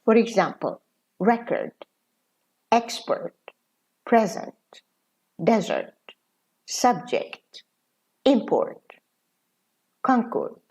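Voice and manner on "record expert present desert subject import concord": noun forms stressed on the first syllable, as in "record". Shown as a demonstration. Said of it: "stress is on the first syllable".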